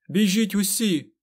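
high, loud, drawn-out order; commanding shout. A narrator's reading. Aes Sedai strains against the Forsaken, shouting orders to flee. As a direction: shout to everyone over danger. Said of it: "наказала Морейн".

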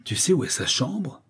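contained ferocious delight; fierce joy. A narrator's reading. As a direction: seize on it with savage glee. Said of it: "avec une joie féroce".

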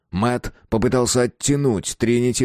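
ordinary delivery

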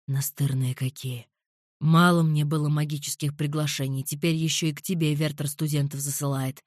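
low muttered grumble; muttering. A narrator's reading. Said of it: "пробормотал Альвир".

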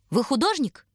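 high, pointedly clear question; deliberately loud, distinct. A narrator's reading. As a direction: ask so that others will hear. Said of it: "нарочно громко и отчётливо спросила она".

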